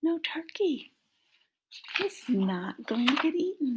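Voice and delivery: high excited whisper. Acted. Reading to children, a high excited whisper. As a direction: whisper it with delight.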